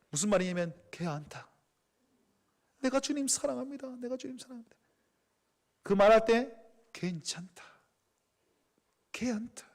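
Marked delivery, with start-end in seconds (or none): 0.9-4.8 tender hush. Acted. Jesus tenderly saying he knows, hushed. 6.9-9.7 whispered, gentle reassurance; comforting whisper. Acted. Jesus whispering comfort over Peter.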